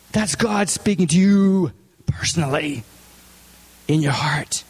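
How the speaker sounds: slow, hushed whisper. Real, not acted. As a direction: lean in and whisper it personally. Sermon, intimate point to listeners.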